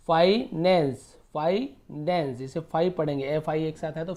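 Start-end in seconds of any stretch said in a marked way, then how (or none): 0.1-1.1 finance slow and loud as "fai - nens"; broken into parts. Shown as a demonstration. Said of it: "ऐसे तोड़ तोड़ के पढ़ना है". 1.3-2.4 finance drawn out as "fai - nens"; slow gap after "fai" in finance; teacher sounding out finance piece by piece; broken into parts. Shown as a demonstration. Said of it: "ऐसे तोड़ तोड़ के पढ़ना है".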